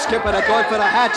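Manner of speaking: loud warning over the chaos; alarmed shout. Acted. Crewman warning the skipper about the hatches, loud alarm. Panicked crew rushing hatches.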